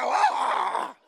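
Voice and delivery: high angry yell of frustration; stressed yell. Retold or imitated. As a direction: explode at the calm advice. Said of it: "I go".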